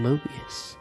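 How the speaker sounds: usual speaking voice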